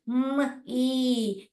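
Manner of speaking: "म, ई" split into two separate sounds. Shown as a demonstration. Teacher sounds out letters for parents: "म, ई" separated. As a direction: sound out "म" then "ई", slowly. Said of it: "नाम बोल रहा है".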